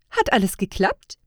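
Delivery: loud, happy check-in; cheerful question. A narrator's reading. Woman elf checking on her colleague, cheerful. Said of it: "begrüßte sie ihn fröhlich".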